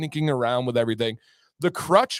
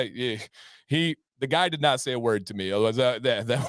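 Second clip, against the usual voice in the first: ordinary delivery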